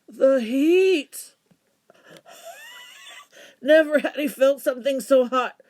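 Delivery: loud, high dramatic narration; dramatic exclamation. A narrator's reading. Narrator feels the heat.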